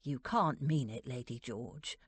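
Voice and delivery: low-voiced butler's protest; low, disbelieving butler. A narrator's reading. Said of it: "Burton made a strangled sound of protest".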